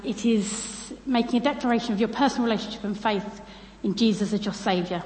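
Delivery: ordinary talk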